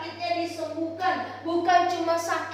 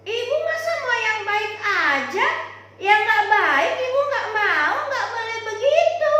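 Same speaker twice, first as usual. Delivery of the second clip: firm husband; 5 semitones higher, 5 dB louder than usual. Acted. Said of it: "bapaknya ngomong gini".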